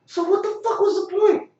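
very high, loud incredulous voice; squeaky exasperated question. Retold or imitated. Asking the guard what the point was, high and incredulous. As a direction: pitch it up in disbelief.

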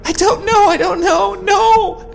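very high, distraught cry; cried. A narrator's reading. Allie breaking down, distraught cry. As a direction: sob out the denial. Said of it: "Allie cried".

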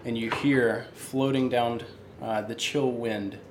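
story narration. Acted. Game master scene setting.

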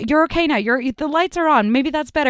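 calm her down gently; comforting a scared player; reassuring. Retold or imitated. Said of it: "he's like".

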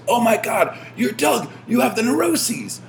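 high-pitched fanboy shriek, acted out; mock shout of starstruck recognition; imitating excited fan. Retold fan recognising the speaker.

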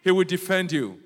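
loud, ringing sermon proclamation; preaching at full force. Acted. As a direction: proclaim it to the congregation with power.

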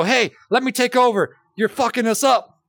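pushy passenger barging in; mock bossy outburst. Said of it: "I can't just go".

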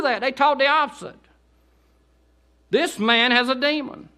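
high, loud fervent preaching; full-force preaching. Acted. Preacher urging the congregation, shouting fervently. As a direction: preach it with fire.

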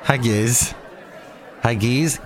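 normal talking voice

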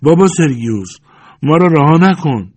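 loud, high pleading shout; high-pitched imploring cry; crowd crying out. A narrator's reading. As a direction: call out to him like a desperate crowd. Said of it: "مردم فریاد زدند".

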